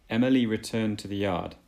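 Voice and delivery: ordinary delivery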